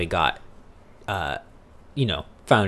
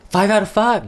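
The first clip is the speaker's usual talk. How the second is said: high, louder mock-enthusiastic rating; joking; 12 semitones higher, 5 dB louder than usual. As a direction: blurt it out high as a joke. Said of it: "No, I'm just kidding".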